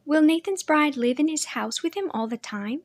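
a curious little sister asking innocently; young sister Leah. A narrator's reading. Little sister asking her brother about brides, girlish and curious.

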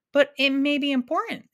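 put-on boy pressing her to answer the phone; insisting. A narrator's reading. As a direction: press the point, lightly urgent. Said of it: "insisted Milo".

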